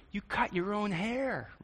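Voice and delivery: can't believe what you see; disbelieving tone. Shown as a demonstration. Preacher showing a judging take, disbelieving. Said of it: "It's a little different".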